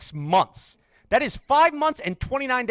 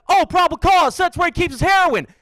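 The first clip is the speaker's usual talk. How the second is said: loud, higher outraged cop shout; mock furious cop, much higher and louder; mock angry cop; 8 semitones higher, 5 dB louder than usual. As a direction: yell it like a cop who got fooled. Cop voiced in joke.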